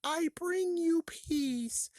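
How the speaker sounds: very high, slow alien monotone. Retold or imitated. Imitating Mister Burns as the alien, high and slow. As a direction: float it out like a spaced-out alien. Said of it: "was like".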